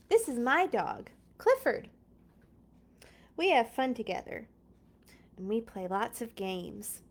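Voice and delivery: lively storybook read-aloud; reading to children. Acted. Reading Clifford aloud to viewers, bright storybook voice. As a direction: read it like story time for little kids. Reads picture book aloud.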